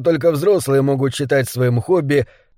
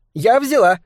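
chirpy; 7 semitones higher than usual. A narrator's reading. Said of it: "без запинки чирикнула Триша".

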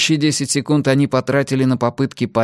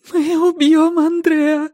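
very high, slow tearful wail; drawn-out crying protest; whimpering; 3 dB louder, slower than usual. A narrator's reading. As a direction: whine it in anguish. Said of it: "хныкала Силья".

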